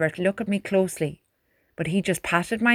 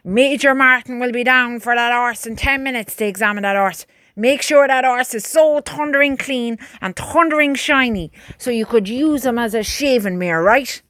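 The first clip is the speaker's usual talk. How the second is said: raised, higher voice barking orders; booming sergeant; 4 semitones higher, 4 dB louder than usual. A narrator's reading. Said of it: "that booming voice".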